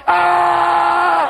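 loud, high yell; De Niro scream. Retold or imitated. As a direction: scream out the window. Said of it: "like De Niro in Mean Streets screaming out the window".

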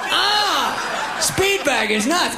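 high, hammed-up lustful raving and yelps; frenzied grandma. Grandma fantasizing about the boy, frenzied high voice. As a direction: build to wild high nonsense cries.

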